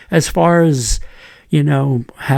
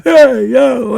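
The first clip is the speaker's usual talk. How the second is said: high-pitched mock call-out; imitated yell; 11 semitones higher, 4 dB louder than usual. Student hailing a priest across the school, imitated yell. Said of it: "and yelled out".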